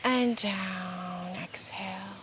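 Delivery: soft whispered cue; whispering. Real, not acted. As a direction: whisper it gently. Whispered cue during breathing practice.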